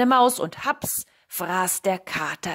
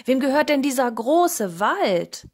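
loud, raised questioning call; 5 semitones higher, 8 dB louder than usual. A narrator's reading. Cat asks woodcutters who owns the forest, calling out. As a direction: shout the question to distant workers. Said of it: "rief der Kater".

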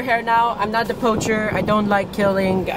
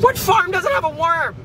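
mock incredulity; 11 semitones higher than usual.

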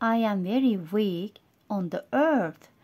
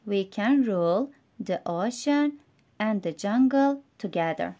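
story reading for learners. A narrator's reading. Reads English story to learners.

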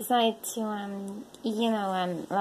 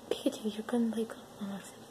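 hushed whisper to the cat; whispering a worried warning to the cat; 7 dB quieter, faster than usual. Real, not acted. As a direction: breathe the warning without voice.

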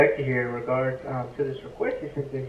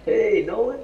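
higher friendly hello; lighter, smiling; 8 semitones higher, 6 dB louder than usual. Shown as a demonstration. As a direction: lighten it but keep volume down. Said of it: "lighten it up".

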